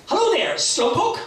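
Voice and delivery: high, cheeky bird voice; Berchicken Hawk. Acted. Hawk greets the buzzard he calls Slowpoke, high cheeky voice. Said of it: "Berchicken Hawk flies up".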